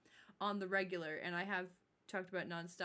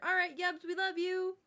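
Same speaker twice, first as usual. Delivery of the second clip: higher and louder, beaming; excited shout-out; 10 semitones higher, 9 dB louder than usual. Real, not acted. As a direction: call out the thanks big. Calling out to raider.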